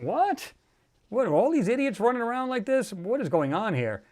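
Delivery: high-pitched incredulous retort. Retold or imitated. YouTuber retelling his reply to doomsday questions, incredulous. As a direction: react in high-pitched disbelief.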